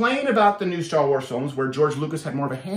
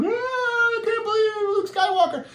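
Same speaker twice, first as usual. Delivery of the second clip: mock crying; 14 semitones higher than usual. Imitating the guy at the Star Wars trailer, mock crying. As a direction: imitate a fan blubbering in a thin high voice. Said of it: "he was crying".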